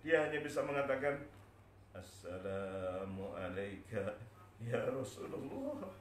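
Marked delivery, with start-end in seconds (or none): 2.0-5.9 slow, spent, drawn-out greeting; voice nearly gone. Acted. Bilal greeting the Prophet's grave, voice used up. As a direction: drag each word out with failing voice. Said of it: "namun suaranya sudah hilang".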